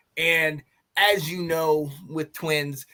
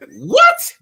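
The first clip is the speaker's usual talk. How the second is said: high, loud yelp of outrage; exasperated shout; fuming disbelief; 13 dB louder than usual. Retold or imitated. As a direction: burst out incredulous, pitch shooting up. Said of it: "I was sitting there fuming".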